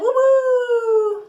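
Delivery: high, loud whoop; excited cheer. Real, not acted. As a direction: cheer like a fan. Cheering the drawn card.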